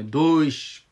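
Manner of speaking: دوش with the "du" dragged long, as the mistake; drawn-out vowel. Shown as a demonstration. Teacher shows learners the faulty stretch, drawn-out vowel in دوش.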